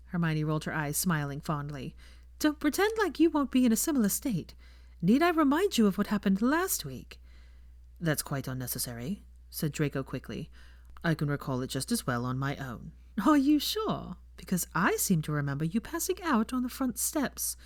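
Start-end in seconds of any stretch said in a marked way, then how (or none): 2.4-7.2 put-on higher, playful young woman ribbing him; teasing Hermione. A narrator's reading. 8.0-12.9 lower, soft and a touch smug; a hint of a confident young man; lightly voiced Draco. A narrator's reading. Draco embarrassed. 13.3-17.6 put-on higher, playful young woman ribbing him; teasing Hermione. A narrator's reading.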